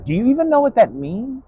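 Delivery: high, sneering question; high mocking retort. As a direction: toss the question back up high in mock disbelief.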